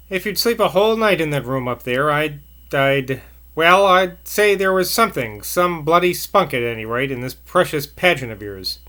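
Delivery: usual speaking voice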